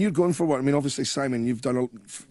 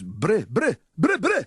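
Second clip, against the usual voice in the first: repeated "bre" leaping high and louder; imitated noise; 7 semitones higher, 3 dB louder, slower than usual. Imitating another performer's thing, noise climbing high and loud. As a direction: fire off the syllable, jumping up in pitch. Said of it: "does this thing when he goes".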